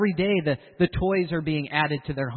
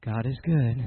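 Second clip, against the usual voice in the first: lower, weary, hollow answer; heavy-hearted pious reply; 6 semitones lower than usual. Retold or imitated. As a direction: mask the struggle behind the phrase. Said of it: "Did you hear the tone in his voice?".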